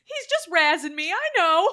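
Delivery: high, loud quote breaking into laughter; laughing quote. Retold or imitated. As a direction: quote her while cracking up. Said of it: "she turns around and goes".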